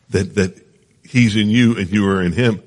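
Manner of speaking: normal talking voice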